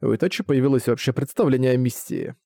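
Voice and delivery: plain reading voice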